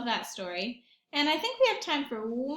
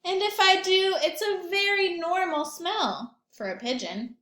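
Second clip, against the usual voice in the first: higher, louder know-it-all pigeon; stubborn pigeon; 7 semitones higher, 6 dB louder than usual. Acted. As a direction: insist you smell fine. Pigeon defends his smell.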